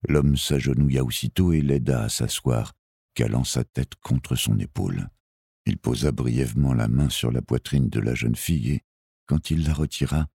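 ordinary delivery

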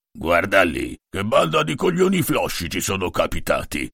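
coarse, mocking big soldier; gruff soldier voice. A narrator's reading. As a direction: sneer at the new recruits. Fratellino's character voice.

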